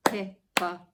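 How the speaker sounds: clapping Hippopotamus for the class; clapped syllables. Shown as a demonstration. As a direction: clap out Hippopotamus. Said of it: "one long one to finish".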